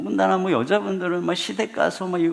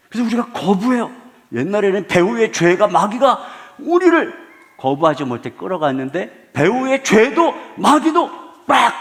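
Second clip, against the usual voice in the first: loud, climbing sermon peak; rising to a fiery sermon climax; forceful preaching; 5 semitones higher, 9 dB louder than usual. Acted. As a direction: preach it out to the whole hall.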